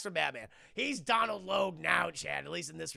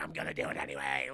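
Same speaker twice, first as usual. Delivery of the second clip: much lower, fast and cold; a low, hammed-up threat; mock menacing villain; faster than usual. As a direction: growl it like a casual villain. Boss coldly refuses.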